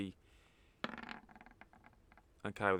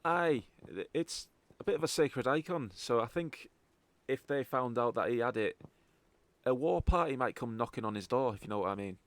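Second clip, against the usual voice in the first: Scottish informant, lifting the opening words; Scottish accent; 2 semitones higher than usual. Acted. Contact warning the party about angry locals, Scottish accent. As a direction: explain it as a Scottish local would. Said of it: "properly in a Scottish accent".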